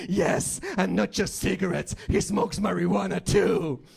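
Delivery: high, loud interrupting sister; shrieky mock tattletale; tattling sister. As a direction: butt in and tell on your brother. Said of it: "my sister interrupts and goes".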